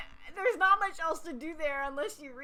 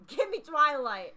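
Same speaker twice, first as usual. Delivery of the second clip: demanding; 2 semitones higher, 8 dB louder, slower than usual. Real, not acted.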